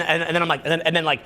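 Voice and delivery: usual speaking voice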